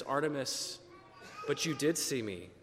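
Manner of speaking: softly spoken Jesus consoling a grieving man; gentle Jesus. Acted. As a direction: reassure him kindly, barely above a murmur. Jesus answers the kneeling man.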